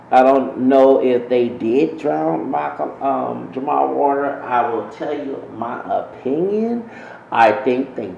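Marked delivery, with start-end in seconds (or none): none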